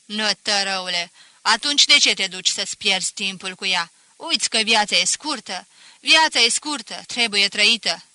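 loud, angry scolding; angry snarl. Acted. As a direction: bite out the insult in anger. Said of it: "Scrâșni".

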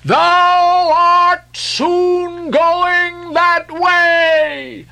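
very high, slow prophetic wail; chanting fanatic's warning; crying out. A narrator's reading. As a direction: pronounce doom in a long high cry. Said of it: "cried Gabriel to Ahab".

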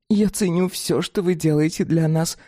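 high, frank creature voice; dog-woman voice. Acted. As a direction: speak as a blunt dog-woman. Fatida explaining their nature.

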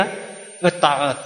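normal talking voice

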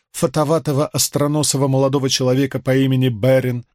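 usual speaking voice

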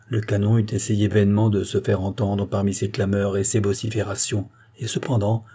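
ordinary delivery

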